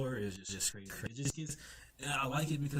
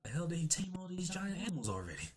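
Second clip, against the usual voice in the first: high-pitched disbelieving question; shocked; 7 semitones higher than usual. Retold or imitated. Little people seeing the tamed giant animals, shocked. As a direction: imitate stunned onlookers, pitched up. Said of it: "just shocked 'cause they're like".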